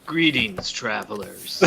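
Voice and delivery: a low, soft character greeting; favorite Italian impression. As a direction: greet travelers in a lightly put-on voice. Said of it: "That's my best Italian impression".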